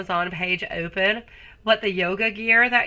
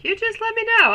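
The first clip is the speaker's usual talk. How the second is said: sudden much higher, teasing singsong; playful high line; 13 semitones higher, 4 dB louder, faster than usual. Acted.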